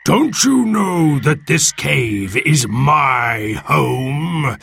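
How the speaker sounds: slow, booming monster demand; monster's yell. Acted. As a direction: challenge the intruders, stretching each word. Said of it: "it yelled".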